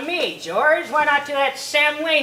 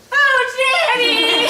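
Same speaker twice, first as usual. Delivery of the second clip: exclaiming; 14 semitones higher, 7 dB louder, much slower than usual. Acted. Mother exclaims at daughter.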